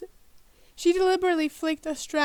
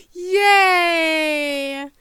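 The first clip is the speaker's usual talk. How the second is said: loud mock cheer; sarcastic yay; 9 dB louder than usual. Real, not acted. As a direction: cheer with heavy irony.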